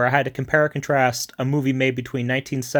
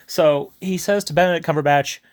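ordinary talk, nothing marked

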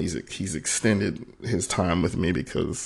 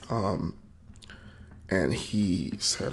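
ordinary talk, nothing marked

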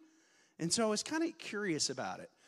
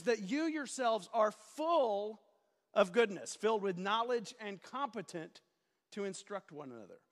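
preacher voice; 4 semitones higher than usual. Real, not acted. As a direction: stretch the key words out like a preacher. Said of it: "stop using preacher voice".

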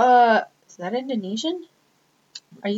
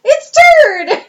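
shouted joking greeting; 10 dB louder than usual. Pretend.

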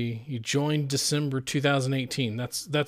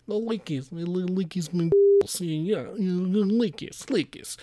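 high, small goofy creature voice; silly put-on voice; 4 semitones higher than usual. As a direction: babble the name in a squeaky, playful voice. Clowning with the name in silly voice.

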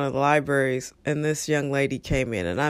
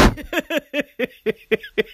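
laughing; 9 semitones higher than usual. Real, not acted. Laughing at the story.

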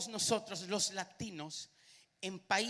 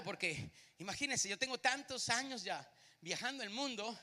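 ordinary delivery

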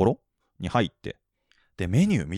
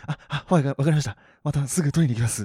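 佐藤二郎風のおどおど声; 4 semitones higher, faster than usual. Retold or imitated. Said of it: "佐藤二郎状態になっちゃうから".

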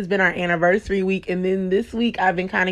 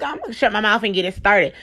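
ordinary delivery

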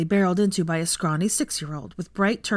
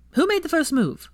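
eager prying question; 10 semitones higher than usual. Acted. Ginny's next question.

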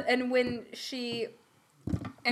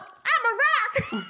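silly rock woman; 11 semitones higher than usual. Acted. Acted woman insisting she's a rock.

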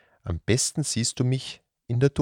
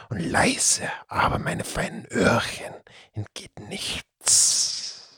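put-on slow, hushed whisper of an old cat-serpent; whispering serpent creature. The Datzelwurm confiding the curse to the children, hushed whisper. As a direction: tell the legend in a secretive whisper.

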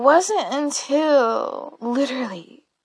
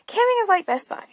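high emphatic repeat; 10 semitones higher, faster than usual. Shown as a demonstration. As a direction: jump way up in pitch on the repeat. Repeats the line for effect.

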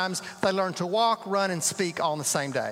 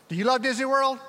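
eager question to audience; 6 semitones higher, 4 dB louder, faster than usual. Real, not acted. Asks congregation.

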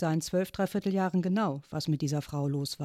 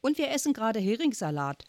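merciless; 6 semitones higher than usual. A narrator's reading. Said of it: "fügte sie unbarmherzig hinzu".

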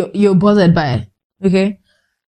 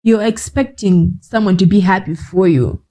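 ordinary delivery

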